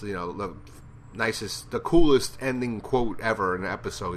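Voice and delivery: ordinary delivery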